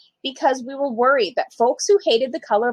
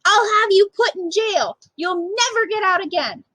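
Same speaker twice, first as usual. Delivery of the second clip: high, raised mock yell; mock yelling mother; 8 semitones higher than usual. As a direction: threaten like an angry mother, pitched up. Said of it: "She immediately started yelling".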